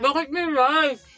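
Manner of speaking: high, slow surprised question. Real, not acted. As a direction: drag it out in disbelief. Sees rice at mukbang.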